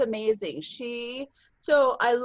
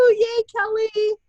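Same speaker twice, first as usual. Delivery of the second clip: high, louder cheer; excited cheer; 10 semitones higher, 4 dB louder than usual. Real, not acted. Host cheering Kelly's team on the call, high excited cheer. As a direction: call out her name in celebration.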